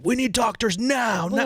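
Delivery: high, drawn-out mock yell; stretched-out, high-pitched urgent demand; imitated screaming. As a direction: imitate a man urgently calling for help. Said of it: "The guy was screaming".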